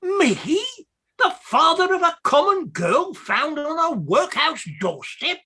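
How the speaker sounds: high, swooping indignation; outraged disbelief. Acted. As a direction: protest as a mortally insulted man. Clerk rejects the paternity charge.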